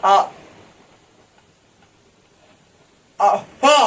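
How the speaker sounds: a slurred फा with open mouth, lips never touching; mispronounced फा, lips held apart; lips apart demo. Shown as a demonstration. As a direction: say फा without closing the lips. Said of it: "बिना मुंह सटैले को ऐसे बोले".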